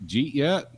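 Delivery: imitating a local's squashed "Jeet yet"; slurred together. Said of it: "where they slur words together".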